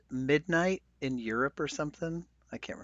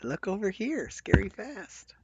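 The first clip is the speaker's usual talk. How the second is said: mock sales pitch; 9 semitones higher, 4 dB quieter, slower than usual. Mocking Apple's distraction pitch.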